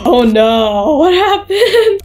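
high, drawn-out whimper; near-crying shock, stretched out. Real, not acted. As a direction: wail it slowly in high-pitched dismay. Shocked at the changed hospital.

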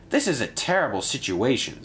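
usual speaking voice

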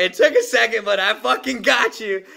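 high, loud victorious shout; gloating loudly after landing the joke; triumphant gloat. Retold or imitated. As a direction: crow it like you won. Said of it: "I was like".

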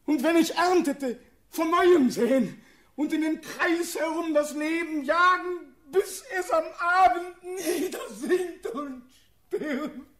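high, loud, mounting desperation; frantic anguish. Acted. Condemned prince picturing a life of lonely toil, frantic anguish. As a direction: drive it upward until the voice nears tears.

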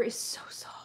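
hushed, airy awe; ASMR whisper. Real, not acted. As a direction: breath-only whisper trailing off. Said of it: "should have done this for the A S M R channel".

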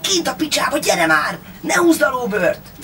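wild junkie yelling to wake him; junkie shouting. A narrator's reading. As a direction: junkie Pista waking his fake father. Junkie urging him to hurry.

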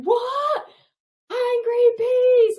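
high, loud, slowed shout of disbelief; shouted confusion. Retold or imitated. Vortex shouting back from the distance, loud high confused call. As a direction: holler back, baffled, from far away.

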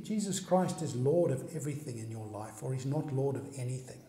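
usual speaking voice

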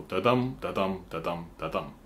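da-dum, softly, dum stressed; stress on the second beat. Shown as a demonstration. Showing viewers the iambic beat, dum stressed. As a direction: tick the beats, hit dum. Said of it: "the emphasis goes on the second syllable".

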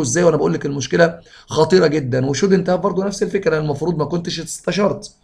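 ordinary talk

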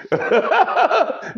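high cackling laugh. Real, not acted. Laughing fit.

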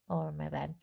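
plain reading voice

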